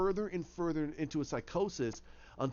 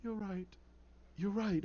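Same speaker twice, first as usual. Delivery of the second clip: soft, higher, defeated admission; 3 semitones higher, 6 dB quieter than usual. Acted. Jervis surrendering to the voice in his head, defeated. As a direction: give in quietly, beaten. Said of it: "He's defeated, and he goes".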